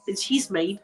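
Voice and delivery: usual speaking voice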